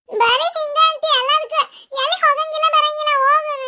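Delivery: high-pitched crying protest; tearful whining. Acted. Sister refused the trip insists on coming, tearful whining. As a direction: whine through stagey sobs.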